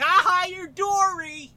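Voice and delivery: high, loud teasing jeer; mocking. Mocking friend for not knowing.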